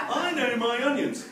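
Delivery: high, laughing brag; laughing boast. Real, not acted. Boasting joke.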